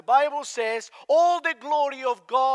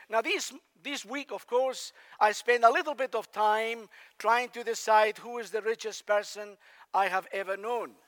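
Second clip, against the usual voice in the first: normal talking voice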